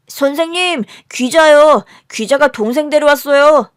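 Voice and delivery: put-on high, loud child's voice; tattling schoolchild. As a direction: call out and tell on a classmate. Classmate tattles to the teacher. (선생님께 고자질하는 아이 목소리)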